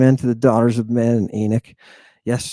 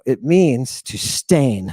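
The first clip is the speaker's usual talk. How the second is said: slow emphatic; 4 semitones lower, slower than usual. Real, not acted.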